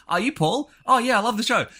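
high-pitched, eager fan voice; imitating a starstruck fan; excited fan. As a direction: jump up in pitch like a thrilled listener. Acts out excited fan greeting him.

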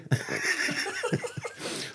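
wheezing squeal of laughter; gleeful airy laugh; high breathy laugh. Real, not acted. Laughing at his taunt.

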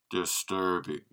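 lightly voiced low Vader echo; Darth Vader impression. Host repeats his Vader line, still low and menacing. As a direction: repeat the word in a low, quiet Vader hush. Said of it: "with the caveat".

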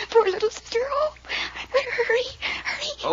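put-on the girl crying and urging him to hurry. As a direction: sob it out for her sister. Girl crying for endangered sister.

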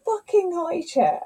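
put-on high, slow little-girl voice shouting from her high chair; a cross toddler demanding out of the chair. Mother imitating her toddler's high-pitched demand from the chair.

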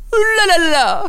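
high squeaky la la la; Jawa cry. Retold or imitated. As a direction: chirp it like a little desert creature. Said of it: "c'est le cri des Jawa".

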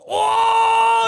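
high shouted battle grunt; imitated grunt. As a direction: shout like a game hero. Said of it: "a lot of the noises".

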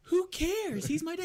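high-pitched exasperated retort; squeaky-high retold protest; mock defensive.

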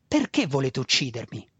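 usual speaking voice

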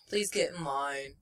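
low, quiet, deadpan official request; retold as a bored guard; unimpressed guard. Guard brushing off an odd request at security, unimpressed. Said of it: "very unimpressed".

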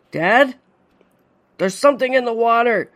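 high, alarmed call; frightened cry. A narrator's reading. Father feeling something below, frightened cry. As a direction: cry out in sudden fear.

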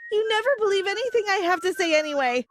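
mild scream of frustration; narrated angry scream. A narrator's reading. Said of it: "I screamed and walked away".

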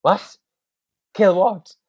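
high, loud horrified yell; horrified mock yell; shocked shout. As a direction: shriek it out in horror. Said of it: "I was like".

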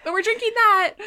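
laughing. Real, not acted. Laughing after joke.

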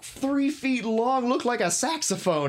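high, gushing delight over the pipe; excited. Real, not acted. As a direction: rave about it, voice jumping up.

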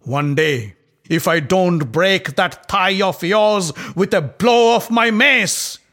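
enraged Bhima threatening Duryodhana; bursting with rage. A narrator's reading. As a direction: hurl a vow of vengeance. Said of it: "Bursting with rage".

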